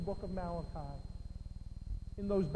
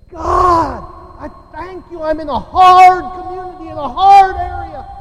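loud, high booming prayer; shouted prayer; 9 semitones higher, 24 dB louder than usual. Retold or imitated. Preacher imitating a friend praying for his hard community, shouting. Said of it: "then he'd say this".

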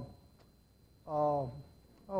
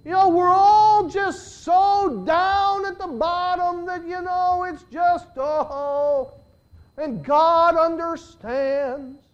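high, slow, whiny mock of a resigned believer; 8 semitones higher, 5 dB louder, slower than usual. Preacher mocks people who say you can't live it, whiny mock voice. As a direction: mimic the hopeless excuse, drawn out high. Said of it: "a lot of people today that say".

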